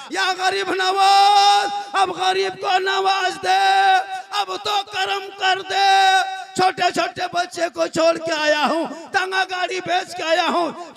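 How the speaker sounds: loud, high, slow wailing plea. Acted. Poor man crying out to Gharib Nawaz, high slow wailing plea. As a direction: cry out to the saint in anguish. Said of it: "रो रो के कहने लगा".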